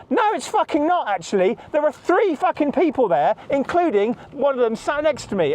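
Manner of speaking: fierce comeback, pitch jumping up in protest; real angry rebuttal. Real, not acted. He snaps back at the healthcare assistant with real anger.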